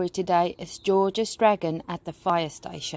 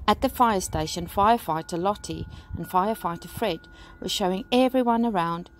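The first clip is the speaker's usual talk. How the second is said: usual speaking voice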